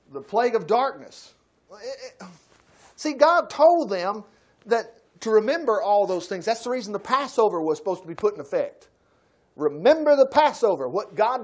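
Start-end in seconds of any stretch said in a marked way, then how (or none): none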